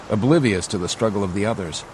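ordinary delivery, nothing marked

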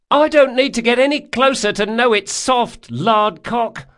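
high, loud jeering yell; taunting shout. A narrator's reading. Girl at the bow mocking galley man, taunting shout. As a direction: shout mockery across the water.

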